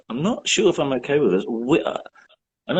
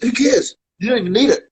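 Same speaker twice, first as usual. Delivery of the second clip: dismissive brag; 7 semitones higher than usual. Retold or imitated. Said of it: "I tried to say".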